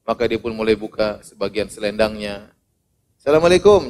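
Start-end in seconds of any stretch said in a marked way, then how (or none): 3.2-3.9 hearty greeting. Acted. Greeting louder each time.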